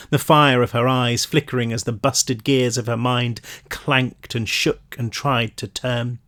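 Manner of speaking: ordinary delivery, nothing marked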